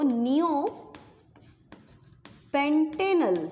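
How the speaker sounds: neopentanal stretched out very slowly; dictating slowly. Shown as a demonstration. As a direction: draw out neopentanal syllable by syllable for dictation. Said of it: "एक नाम दूं आपको". (neopentanal बहुत धीरे खींचकर बोलना)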